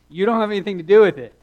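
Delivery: loud, high, quick Alabama retort; Alabama drawl. Retold or imitated. Classmate putting the preacher right, loud Alabama drawl. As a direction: fire it back in a backwoods Alabama accent. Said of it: "my best Alabama drawl".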